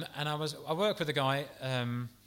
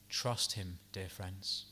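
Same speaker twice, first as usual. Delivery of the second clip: soft, lower plea to trust; tender appeal; 7 semitones lower, 6 dB quieter, slower than usual. Real, not acted. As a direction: gently urge the flock.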